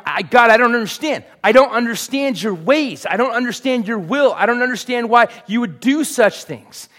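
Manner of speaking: high, loud anguished plea; wrestling with God. Acted. Habakkuk pleading with God he doesn't understand, loud and high. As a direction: cry out in baffled protest. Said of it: "he's wrestling with the things of God".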